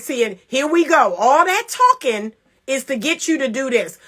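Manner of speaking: ordinary talk, nothing marked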